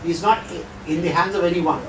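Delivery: usual speaking voice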